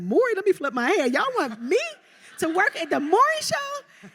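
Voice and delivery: very high, giddy self-quote; starstruck disbelief. Retold or imitated. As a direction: squeal it like a flattered, amazed hire. Acting out reaction to job offer.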